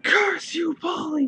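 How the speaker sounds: high, loud anguished cry; shouted curse. Acted. Jason cursing Polly from the bridge, high and loud. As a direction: hurl the curse with everything. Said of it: "he shouted".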